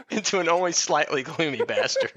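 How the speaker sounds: laughing at the last word; laughing through it. Real, not acted. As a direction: break into laughter on the punchline.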